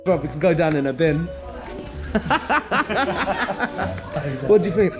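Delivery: ordinary talk, nothing marked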